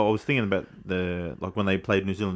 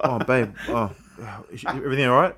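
pretending to sleep; 3 semitones higher than usual. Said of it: "you talk like".

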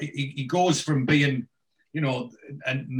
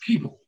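"People" higher and set apart; disdainful emphasis; 4 semitones higher, 3 dB louder than usual. Retold or imitated. Repeating Arthur's word for the listener, disdainful "People". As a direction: pronounce "People" with mock distaste. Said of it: "the way that he says".

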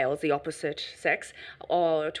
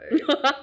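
burst of laughter; 9 semitones higher, 6 dB louder than usual. Real, not acted. Laughing at low expectations.

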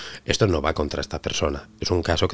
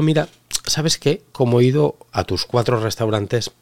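mock cheeky diner; 6 semitones higher, slower than usual. Acts cheeky discount-seeking diner.

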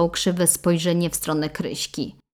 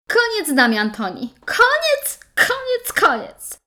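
high-pitched, loud repeated cry; shouting; 4 dB louder than usual. Acted. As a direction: shout it, ending everything. Bride declares it over.

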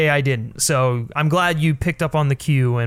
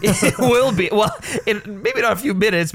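laughing; 6 semitones higher than usual. Real, not acted. Laughing while answering co-host.

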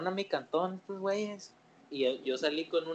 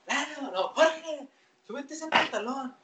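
higher, half-laughing mock yell of a mom; mother yelling; 9 semitones higher, slower than usual. Mom yells at son to pull up pants, mock shout. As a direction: call out like a mom catching her son. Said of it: "mi jefa me grita".

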